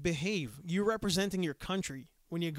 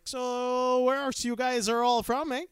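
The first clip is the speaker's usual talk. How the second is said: higher, louder, slow drawn-out question; hockey-fan giant; 6 semitones higher, 6 dB louder, slower than usual. Retold or imitated. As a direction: lean into the Canadian eh. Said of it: "and he's like".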